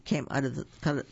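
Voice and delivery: ordinary delivery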